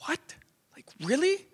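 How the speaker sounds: high incredulous rise; stunned high-pitched really; disbelieving really. Real, not acted. Incredulous reaction.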